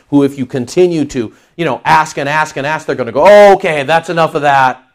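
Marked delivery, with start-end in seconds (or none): 3.3-4.8 loud, exasperated ruler cutting off a petitioner; fed-up earthly king. Acted. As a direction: act out a king who has heard enough. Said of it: "like an earthly demagogue, like an earthly king".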